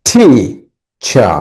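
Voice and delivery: টি, চা as two separate beats; syllable by syllable. Shown as a demonstration. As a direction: split টি, চা with a clear gap, first beat stressed. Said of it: "একটা করে হাততালি দিবে".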